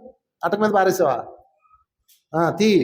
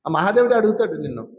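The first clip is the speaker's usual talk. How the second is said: ordinary talk, nothing marked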